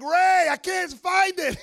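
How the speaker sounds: high, loud, slow whine of "Ray, I can't find it"; whiny husband. Retold or imitated. Husband yelling to his wife that he can't find it, high whine. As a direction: call out helplessly from the other room. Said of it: "I'm like".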